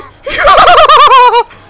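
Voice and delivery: loud, high creepy witch cackle; creepy laugh. Acted. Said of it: "Eva started laughing in a creepy voice".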